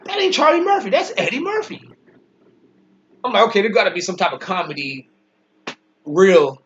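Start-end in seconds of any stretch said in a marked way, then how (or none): 0.1-1.9 pitched up high in disbelief; the double take when it finally clicks; high, stunned correction; sudden realization. Retold or imitated. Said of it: "like, wait a minute".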